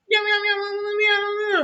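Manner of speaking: very high, soft mock cat mewing; cat meows. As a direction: mew like a little kitten. Imitating a cat in the story.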